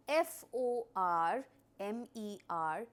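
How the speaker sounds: slow, letter by letter spelling. Shown as a demonstration. Teacher spells the word to students, slowly, letter by letter. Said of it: "So the spellings are".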